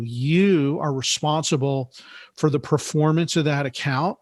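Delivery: usual speaking voice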